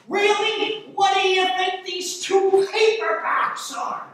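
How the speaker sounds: loud, high-pitched indignant challenge; indignant author. Acted. Affronted author confronts the clerk.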